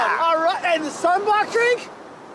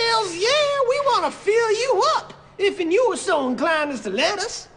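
put-on loud, whooping Southern accent; an eager, hammed-up Southern oilman; 4 semitones higher, 4 dB louder than usual. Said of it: "We would have Southern accents".

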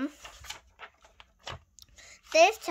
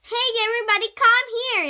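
high, loud gathering call; yelling; 11 semitones higher, 10 dB louder, faster than usual. A narrator's reading. Classmate calling everyone to the fight, yelling. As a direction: shout to summon the whole crowd. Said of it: "yelled Jessie".

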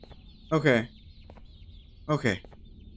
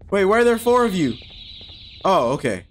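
normal talking voice

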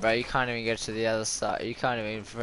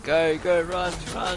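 urgent shout; 5 semitones higher, 4 dB louder than usual. Real, not acted. Urging teammate to run.

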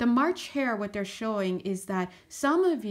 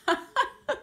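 burst of high laughter; 12 semitones higher than usual. Real, not acted. Spirits laughing at her.

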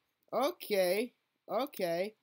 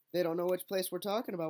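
ordinary talk, nothing marked

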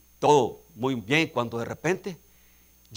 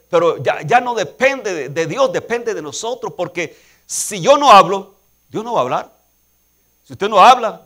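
loud, high, driving sermon climax; preaching at full force; 5 semitones higher, 6 dB louder than usual. Acted. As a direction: preach it hard, pushing each line louder. (predicación a todo pulmón, alta y fuerte)

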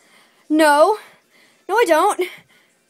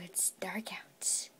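slow hushed whisper into the dark; 10 semitones lower, 21 dB quieter, slower than usual. Acted. Shopkeeper closing the store at night, slow whisper. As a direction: breathe it out slowly, barely voiced.

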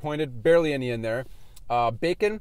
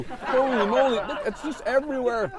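laughing; 6 semitones higher than usual. Real, not acted. Sauce everywhere.

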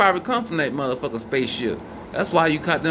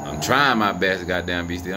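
ordinary talk, nothing marked

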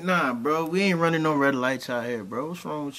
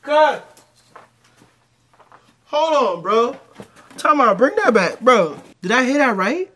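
loud, high stunned interruption; shocked outburst; 6 semitones higher, 8 dB louder than usual. Real, not acted. Man halting a clip he can't believe, loud and high. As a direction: stop everything in disbelief. Stopping the video in disbelief.

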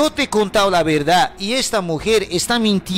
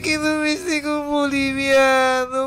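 weeping lament; 5 semitones higher, slower than usual. Acted. Wife accuses bolivian husband, weeping.

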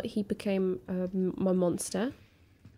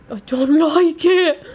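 slow, high, loud fearful laugh-whine; nervous wail; 6 semitones higher, 15 dB louder than usual. Real, not acted. Player hearing the creature, slow nervous wail. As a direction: let the fear leak into a laugh. Tense moment in horror game.

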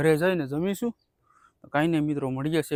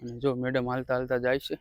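ordinary talk

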